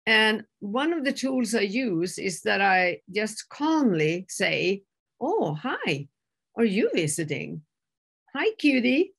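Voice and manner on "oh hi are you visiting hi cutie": playful sing-song hello with swooping pitch; calm, humorous greeting. Retold or imitated. Calmly greeting her red dog, playful and humorous. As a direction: greet a cute visitor lightly, pitch lifting on the hellos. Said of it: "I make it a little humorous".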